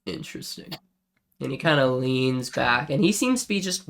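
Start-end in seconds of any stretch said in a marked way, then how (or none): 0.0-0.7 whispered, half to himself; hushed intrigue. Acted. Dwarf leaning back watching the halfling, whispered. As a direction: breathe it out with quiet interest. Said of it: "he goes".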